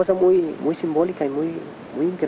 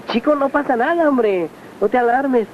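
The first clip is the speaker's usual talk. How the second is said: much higher, slow and easygoing calming; easy reassurance; 10 semitones higher than usual. Retold or imitated. Master calming his alarmed follower, slow high reassurance. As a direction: wave off the worry, unhurried.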